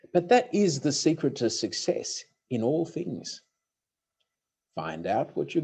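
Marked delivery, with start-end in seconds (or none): none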